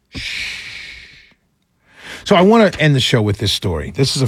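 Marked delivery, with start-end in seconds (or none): 0.1-1.3 soft unvoiced shh; shushing. Host hushing listeners about the deficit. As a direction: hush the audience like a secret. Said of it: "Let's not tell anybody that".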